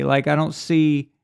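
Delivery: ordinary talk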